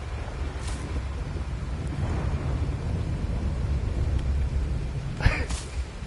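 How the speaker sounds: high, airless laughter that runs on and on; long high wheezing laugh. Real, not acted. Laughing hard at his own punchline.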